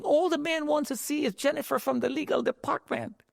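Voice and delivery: high, insistent voice of Albert; urgent plea. Retold or imitated. Albert to the guards at the front desk, high insistent plea. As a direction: press the point high and urgent.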